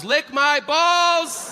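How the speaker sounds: high, long-stretched yelp; Tourette's outburst. Acted. Kid in church interrupting the Bible reading, high blurted outburst. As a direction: draw the curse out high and involuntary.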